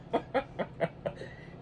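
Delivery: soft airy chuckle, high; breathy laugh. Real, not acted. Laughing after wife story.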